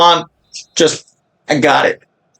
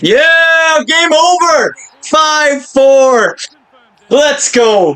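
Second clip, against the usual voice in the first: real shouted joy, much higher; screaming celebration; 12 semitones higher, much slower than usual. Real, not acted. Gamer screaming with joy at the final whistle.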